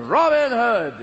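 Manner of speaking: usual speaking voice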